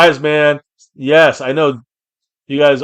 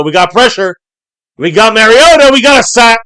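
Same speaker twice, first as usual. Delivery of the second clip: rising excitement, louder with every line; excited call; 7 semitones higher, 8 dB louder than usual. Real, not acted. Streamer calling a live sack for his chat, building excitedly. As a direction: voice climbing as the pass rush closes in.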